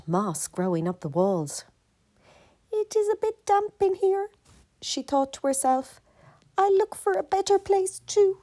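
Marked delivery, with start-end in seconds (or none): 2.7-8.4 high little mouse musing; a tiny mouse thinking aloud, high; small mouse voice. Acted. Mouse deciding to leave the damp hole, high and small.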